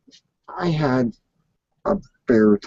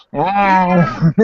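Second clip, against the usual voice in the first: high, loud playful meow; cat meow; 6 semitones higher, 5 dB louder than usual. Pretend. Streamer in cat face filter meows at chat, high loud playful meow. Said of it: "you got the cat now".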